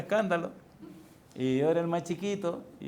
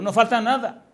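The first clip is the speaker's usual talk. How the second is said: higher and louder, hammering it home; emphatic shout; 7 semitones higher, 8 dB louder than usual. Real, not acted. Preacher insisting to his congregation, with a loud emphatic shout. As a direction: declare it like a settled verdict.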